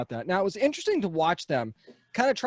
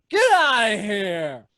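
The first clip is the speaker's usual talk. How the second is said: loud, drawn-out impersonated line; John Reynolds; 3 semitones higher, 5 dB louder, slower than usual. Acted. Host signs off imitating his guest, loud slow impression. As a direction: call it out slowly as an impression. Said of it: "That was a great John Reynolds impression".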